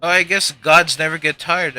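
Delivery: ordinary delivery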